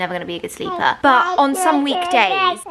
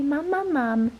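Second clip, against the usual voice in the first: higher, softer sing-song prompt to copy; coaxing baby talk; 4 semitones higher, 4 dB quieter, slower than usual. Shown as a demonstration. Mum again teaching her baby to say mama, in a gentle sing-song. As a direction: sound it out gently for the baby to repeat.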